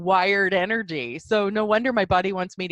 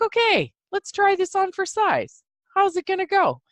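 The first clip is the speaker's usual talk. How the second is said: excited inner voice; 11 semitones higher than usual. Retold or imitated. Inner voice deciding to try.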